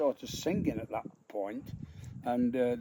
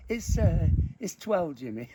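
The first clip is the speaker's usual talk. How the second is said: giggly, higher reply; laughing through the answer; 5 semitones higher, 4 dB louder than usual. Retold or imitated. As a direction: barely hold the laugh in. Giggling answer to Jimmy Tarbuck.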